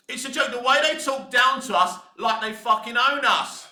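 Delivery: normal talking voice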